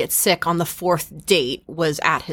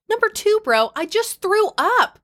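pitched up, exasperated repeat; 13 semitones higher than usual. Retold or imitated. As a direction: say it like you've lost patience. Retold quote, rising exasperation.

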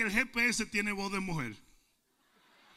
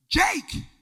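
raised call across the yard; calling the dog; 8 semitones higher, 7 dB louder than usual. Retold or imitated. Preacher calling his dog, raised call. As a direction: call the dog by name, up high.

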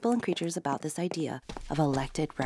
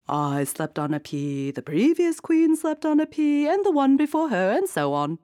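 high, loud regal listing; queen boasting of tradition; 11 semitones higher, 7 dB louder than usual. Acted. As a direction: list it proudly. Queen voiced in story.

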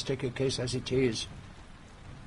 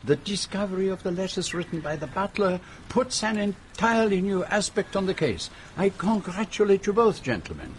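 put-on excited French-accented praise, pitched up; french chef; 6 semitones higher than usual. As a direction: gush like a delighted Frenchman. Poirot enthuses in French accent.

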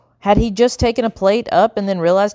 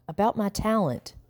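moaning; 3 semitones higher, 8 dB quieter than usual. A narrator's reading. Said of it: "Howard moaned".

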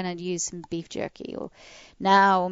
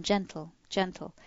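soft command; 6 dB quieter than usual. Shown as a demonstration. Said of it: "I tell them".

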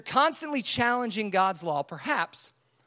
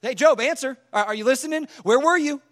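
loud, raised-pitch badgering; hectoring, building to a shout; God grilling Job; 3 semitones higher, 5 dB louder than usual. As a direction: pepper Job with insistent questions. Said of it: "He'll continue to say".

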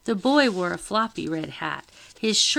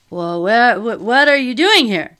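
loud, high, flustered demand; alarmed man raising his voice; 4 semitones higher, 12 dB louder than usual. A narrator's reading. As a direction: demand it at full volume, rising in pitch. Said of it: "demanded the round man".

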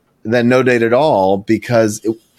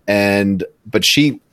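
ordinary talk

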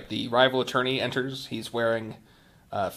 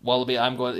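ordinary talk

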